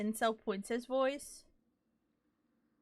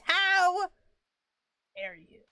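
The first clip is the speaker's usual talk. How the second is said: mock offended spirit voice, dropping off on dare; disembodied voice; 6 semitones higher, 6 dB louder, slower than usual. Imitating the disembodied game voice saying how dare you. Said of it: "How dare you in disembodied voice".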